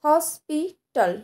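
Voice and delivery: Hospital slowly as হস, পি, টাল; hospital syllable by syllable, pausing between; split into three syllables. Shown as a demonstration. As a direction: break hospital into three spaced beats. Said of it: "এখানেও তিনবার উচ্চারণ করতে হচ্ছে".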